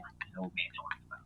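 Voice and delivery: hushed, quick aside to himself; whispered puzzled grumble; muttered aside. Retold or imitated. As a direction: retell a private doubt under your breath. His private aside about the mentor.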